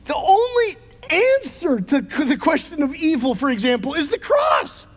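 rising to a shout on cross; fiery preacher peak; preaching climax. Real, not acted.

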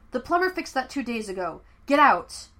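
rising from stern to loud order; commanding. Acted. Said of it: "commanded the cook".

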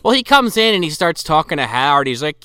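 ordinary talk

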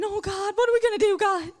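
high, fast, frantic mock panic; mock panicked prayer. Preacher mimics panicked believer crying out to God. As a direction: ham up a freaked-out prayer. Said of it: "start freaking out and saying".

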